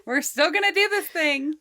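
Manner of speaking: high, bright, laughing it off; high cheerful relief. Pretend. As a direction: shrug it off cheerfully together. Joking chant together.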